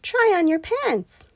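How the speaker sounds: high, slow coaxing; lightly voiced wife; wife urging. A narrator's reading. Daughter urges.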